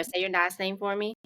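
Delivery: normal talking voice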